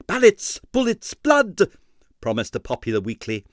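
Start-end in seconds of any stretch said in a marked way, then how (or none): 0.1-1.7 high, loud punchy slogan; headline cry. A narrator's reading. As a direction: bark each word like a banner headline. Headline shouted.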